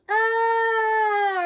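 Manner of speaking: long high loud falling scream. Acted. Arthur jumping off the cliff, loud high scream. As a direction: scream as you drop off the cliff. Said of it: "Arthur screamed".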